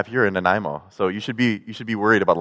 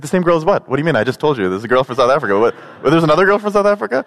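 higher, fast, baffled questions; confused friend; 6 semitones higher, 4 dB louder, faster than usual. Retold or imitated. Friend puzzled about two South African girls, higher and rushed. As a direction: rattle it off, bewildered. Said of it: "It's like".

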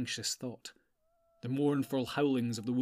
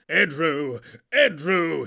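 loud ringing command, rising on the second; loud wrathful command; commanding cry; 4 semitones higher, 10 dB louder, slower than usual. A narrator's reading. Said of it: "he cried".

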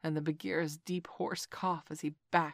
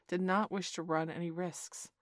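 ordinary delivery

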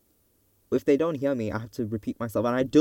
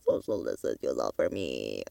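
suddenly very low mock-stern plea; low, put-on admonishing tone; put-on deep voice; 11 semitones lower, 4 dB quieter than usual. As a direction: drop the voice right down for a comic request. Acted plea, voice suddenly dropped.

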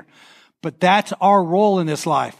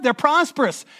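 ordinary delivery

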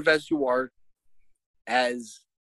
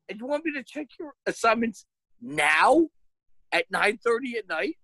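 high-pitched indignant dad rant; retold outraged disbelief, voice pitched up; incredulous; 12 semitones higher than usual. Dad asked to check assignments at night, incredulous.

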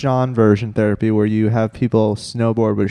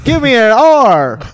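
slow, loud, higher call; slow loud game show call; 10 semitones higher, 5 dB louder, slower than usual. Retold or imitated. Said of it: "you're like".

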